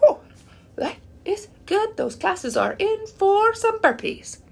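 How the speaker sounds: gleeful. Retold or imitated. Waking refreshed at four, high and gleefully teasing her classes. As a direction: savor how good you feel.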